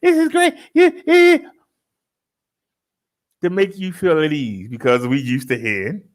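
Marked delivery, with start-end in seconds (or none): 0.0-1.4 high, bright chirpy recorded-lady voice; much higher, sing-song recording voice. Retold or imitated. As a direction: play the cheerful robocall lady.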